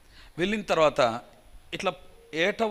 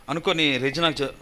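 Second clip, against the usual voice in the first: ordinary delivery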